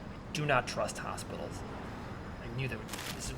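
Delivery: low, hushed distrustful mutter; lightly voiced suspicious loner; paranoid stranger.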